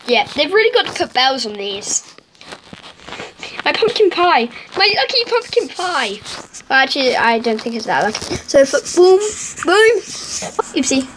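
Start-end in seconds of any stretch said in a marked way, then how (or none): none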